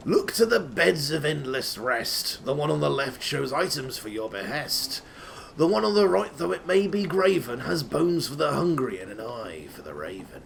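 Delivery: ordinary delivery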